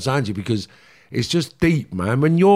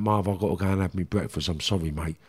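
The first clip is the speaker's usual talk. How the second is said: lower, softer hurried excuse; dismissive brush-off; 5 semitones lower, 5 dB quieter than usual. Retold or imitated. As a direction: fob him off politely and leave. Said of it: "He went".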